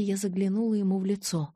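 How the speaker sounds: usual speaking voice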